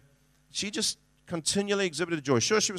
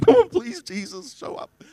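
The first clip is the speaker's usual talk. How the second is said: desperate plea; 10 semitones higher, 4 dB louder than usual. Retold or imitated. Desperate prayer retold with laugh.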